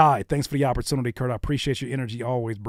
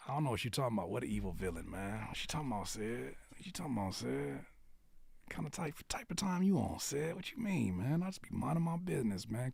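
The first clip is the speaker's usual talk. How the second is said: muttered aside; 2 semitones lower, 12 dB quieter than usual. Real, not acted. Host muttering to Sid about minding his business, softly. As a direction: grumble it well under your usual level.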